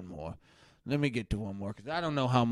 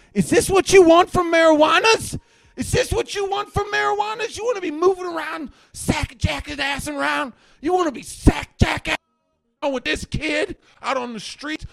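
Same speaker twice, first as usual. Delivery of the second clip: very high, loud hysterical rant; outraged shouting; 10 dB louder than usual. Acted. Ranter warns viewers off marijuanas, high outraged shouting. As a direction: screech it at the viewers, worked up.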